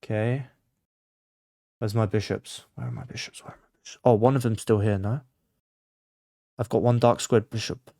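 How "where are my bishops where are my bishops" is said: low murmur sinking into a whisper; fading mutter. Real, not acted. Player hunting for lost bishops, fading self-directed mutter. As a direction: repeat it to yourself, dropping to a bare whisper.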